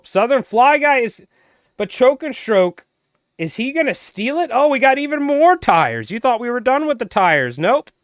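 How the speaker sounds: high, loud, racing play-by-play; excited race call. Real, not acted. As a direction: call the finish like a track announcer. Race finish, rising commentary excitement.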